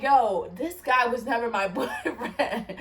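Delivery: amused disbelief, giggling on the words; retold dying laughing. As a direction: protest broken up by laughter. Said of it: "I start dying laughing because I'm like".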